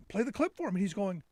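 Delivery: ordinary talk, nothing marked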